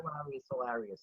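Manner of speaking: soft fading echo of hilarious; quiet echo. Real, not acted. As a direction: repeat hilarious to yourself under your breath. Savoring the word, repeats it.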